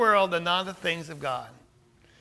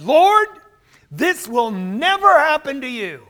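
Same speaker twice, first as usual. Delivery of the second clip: high, loud objection as Peter; prideful protest; 4 semitones higher, 6 dB louder than usual. Retold or imitated. Peter takes Jesus aside to contradict him, emphatic protest. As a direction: protest emphatically, pitched well up. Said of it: "that's a pride issue".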